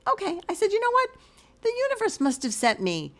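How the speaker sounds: retold high-pitched burst of realisation; amazed acceptance. Gym-goer to the woman who persuaded her to stay, amazed. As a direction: lift the pitch high, as if struck by a sign.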